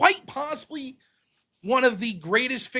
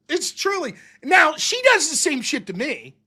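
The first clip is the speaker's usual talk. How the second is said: ordinary talk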